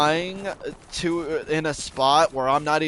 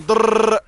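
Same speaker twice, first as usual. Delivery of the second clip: loud rolled-r gun rattle, higher; mock gunfire; 7 semitones higher, 8 dB louder than usual. Describing how Sam shot him, a loud mock gunfire rattle. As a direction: rattle it out like a machine gun burst.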